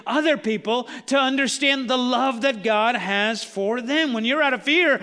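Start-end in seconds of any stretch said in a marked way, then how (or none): 4.1-5.0 loud, ringing preacher climax; full-force preaching. Acted. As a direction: drive it home to the congregation.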